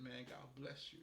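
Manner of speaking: ordinary delivery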